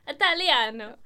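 loud hammed-up Italian; mock Italian. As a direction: belt it like a stage Italian. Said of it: "It sounds Italian".

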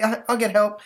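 put-on high, loud kid reassurance; calm him in a boy's voice; reassuring boy. Stanley promising help to Nasty, high kid voice.

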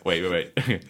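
ordinary talk, nothing marked

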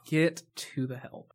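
drawn-out, understated movie quote; slow deliberate quote. Retold or imitated. Repeating the movie's best line, slowly and understated. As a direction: stretch each word without raising the voice.

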